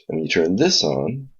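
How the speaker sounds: normal talking voice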